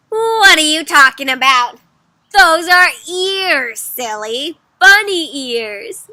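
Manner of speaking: loud, drawn-out storybook protest; hammed-up disbelief, stretched words; exasperated correction. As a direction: correct a silly friend emphatically. Rabbit believer argues back.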